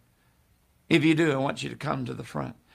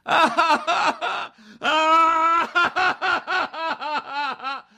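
high wailing mock sobs, much higher and louder; imitated blubbering sobs of a broken proud man; imitating boo-hoo sobbing; 11 semitones higher, 5 dB louder, much slower than usual. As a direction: bawl like a grown man undone. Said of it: "He's boo-hooing like".

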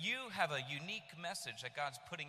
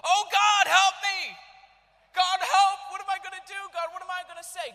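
frantic shouted prayer, much higher and louder; desperate cry for help; 13 semitones higher, 17 dB louder than usual. Retold or imitated. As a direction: cry out to God in panic. Retold panicked prayer in closet.

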